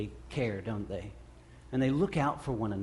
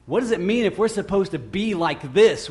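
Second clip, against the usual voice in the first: normal talking voice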